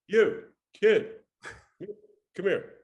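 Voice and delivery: loud, high call across a lobby; curt summons. Retold or imitated. Suited agent to waiting kid, curt summons. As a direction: call the kid over with authority.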